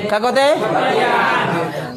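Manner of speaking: long, slow "क्या कहते हैं?"; drawn-out prompt. Shown as a demonstration. Teacher prompting the class to answer, drawn out. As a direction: stretch the question slowly to invite an answer. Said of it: "prompts class to answer".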